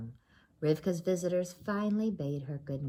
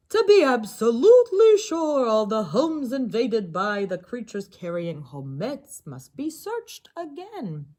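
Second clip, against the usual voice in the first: rabbi's pronouncement; 4 semitones higher, 9 dB louder than usual. Acted. Rabbi's ruling voiced.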